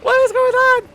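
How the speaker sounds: high, loud panicky shouting; frantic shout. Real, not acted. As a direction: shriek in a frenzy. Overwhelmed by raid and monsters.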